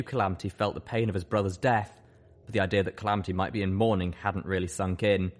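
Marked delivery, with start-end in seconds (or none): none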